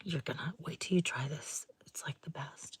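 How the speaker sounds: low, very soft whispered imitation; confiding whisper. Sampler girl urging a customer to try gelato, confiding whisper.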